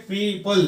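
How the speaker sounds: "people" split as pee-pul; two syllables. Shown as a demonstration. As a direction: break people into two spaced parts. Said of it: "टू syllables है". ("people" दो हिस्सों में तोड़कर)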